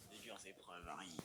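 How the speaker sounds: low ominous whisper; whispered narration. A narrator's reading. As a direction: breathe the warning in a whisper.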